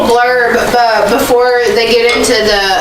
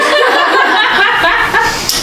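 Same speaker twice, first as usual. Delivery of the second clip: high-pitched burst of giggling; a helpless little giggle; high laugh; 11 semitones higher than usual. Real, not acted. Laughing at reading mistake.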